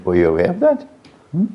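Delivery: ordinary talk, nothing marked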